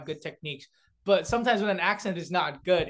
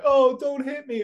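whiny, pleading higher voice, slowed; acting stupid; 8 semitones higher, 6 dB louder, slower than usual. Kid retelling how he begged bullies not to hit him, mock pleading. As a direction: play the pitiful kid begging not to be hit. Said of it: "I would just be like, really act stupid".

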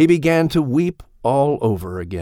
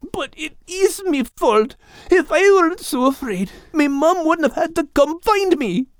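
high puppy voice, insisting it is his fault; a young pup pushing back through guilt; protesting. Acted. Puppy Max argues the guilt is his, high and insistent. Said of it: "Max protested".

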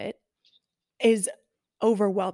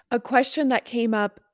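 normal talking voice

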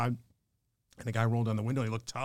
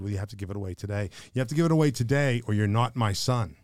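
raised, emphatic voice of a father laying down the law; stern father's rebuke; 2 semitones higher, 6 dB louder than usual. Retold or imitated. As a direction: imitate a father forcefully admonishing his son. Father sternly rebuking his son.

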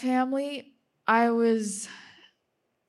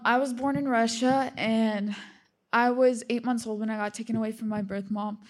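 ordinary talk, nothing marked

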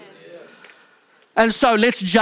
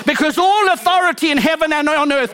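high-pitched, driving sermon delivery; fervent preaching; 6 semitones higher than usual. Real, not acted. Preaches at full force.

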